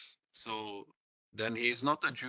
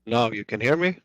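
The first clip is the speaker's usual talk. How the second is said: much louder, almost shouting; to someone hard of hearing; 13 dB louder than usual. Real, not acted. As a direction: push the voice so they hear. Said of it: "speak louder".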